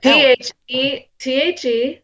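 spelling it out. Real, not acted. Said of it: "spell it".

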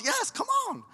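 high-pitched enthusiastic call; eager invitation. Acted. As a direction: call them over, voice leaping up. Acting Jesus' response to Peter.

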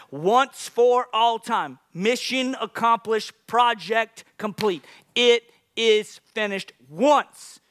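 slow, high, loud word-by-word emphasis; slow rising emphasis; slow emphatic preaching. Acted. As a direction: land each word like a hammer.

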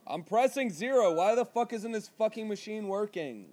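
high, loud, fed-up outburst; exasperated. Acted. Caller fed up with the hospital phone menu, exasperated.

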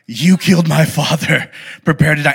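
a touch of raspy swordsman menace; lightly rasped vow of revenge; mild raspy movie threat; raspy Inigo Montoya. Retold or imitated. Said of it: "you gotta do it with the raspy voice".

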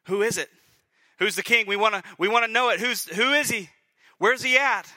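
loud, high, insistent questions; mock crowd clamoring to know; crowd demanding. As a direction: press the question like an eager crowd. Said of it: "the crowd blatantly asks the question".

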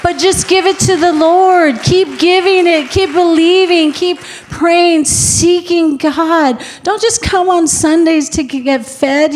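high, loud preaching push; full-force preacher voice; fiery preaching. Acted. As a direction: drive each line home to the congregation.

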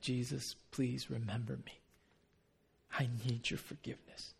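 very soft, low penitent plea; humble plea. Retold or imitated. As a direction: beg gently, barely above a hush. Voices the penitent criminal's plea.

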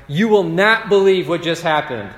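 bursting with the news; excited friends. Retold or imitated. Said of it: "his friends find him and they're like".

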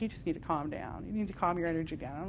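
a hint of a soft condescending adviser; mock calm-down advice. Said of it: "how much I get that feedback of like".

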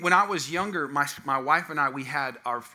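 ordinary delivery

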